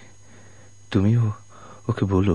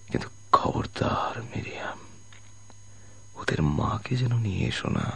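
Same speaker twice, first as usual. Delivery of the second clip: urgent hushed whisper; whispered warning; 9 dB quieter than usual. Acted. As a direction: warn her under your breath. Whispered warning near death. (ফিসফিস করে সাবধান করা)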